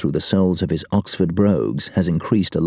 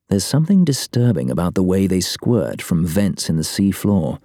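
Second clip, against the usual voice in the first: usual speaking voice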